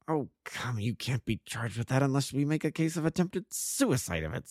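lightly amused, said through a slight grin; grinning. A narrator's reading. Inspector humouring the unmasked prisoner, grinning. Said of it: "said the inspector with a grin".

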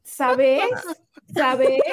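Mexico City accent. Shown as a demonstration. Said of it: "Eso es como muy de la Ciudad de México".

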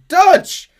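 cracking shout; desperate high yell, very loud; Arthur. Acted. Arthur shouting after Dutch, voice-cracking yell. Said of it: "voice is cracking as he's shouting at Dutch".

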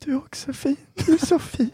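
squeaky lovestruck voice. Retold or imitated. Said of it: "kan jag också bara".

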